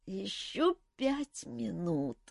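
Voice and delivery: slow, soft sleepy mumbling; sleepy mumble. A narrator's reading. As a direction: mumble it half asleep. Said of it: "сонно пробормотала Ольга".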